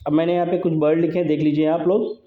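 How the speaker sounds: ordinary talk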